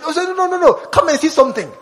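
hammed-up pleading street invite; mock pushy invite. Evangelist coaxing a stranger, mock eager. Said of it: "they have said".

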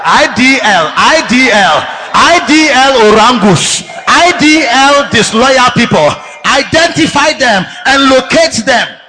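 punchy, emphatic chant-like commands; forceful preaching. Real, not acted. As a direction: drive each letter home to the congregation.

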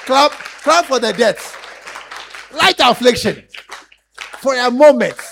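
loud preaching with laughter in the voice; full-force preaching. Real, not acted. Preacher rousing the congregation, loud and laughing.

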